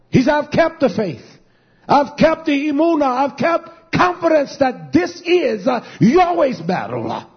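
high, driving pulpit cry; rousing sermon at full voice; impassioned preaching. Real, not acted. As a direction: preach it hard with punched phrase endings.

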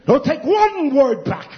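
loud, high and slow declaration; emphatic pulpit shout; defiant preaching shout. Real, not acted. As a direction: thunder it out slowly, refusing to back down. Preacher defiantly stands by his tirade.

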